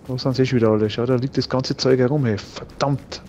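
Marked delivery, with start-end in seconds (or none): 2.4-3.2 muttered under breath; whispered curse. Real, not acted. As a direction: hiss it quietly to yourself. (geflüstert geflucht)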